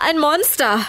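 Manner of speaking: high, frightened squeal at moderate volume; shrieking. A narrator's reading. Tom sees a figure leave the undergrowth, shrieking high. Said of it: "kreischt Tom".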